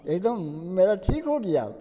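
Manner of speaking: voiced high and loud, a little quick, overjoyed; an old man thrilled his headache is gone; delighted relief. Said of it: "तो कहते हैं". (खुशी से ऊँची आवाज़ में)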